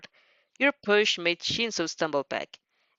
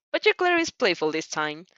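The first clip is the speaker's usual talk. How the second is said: usual speaking voice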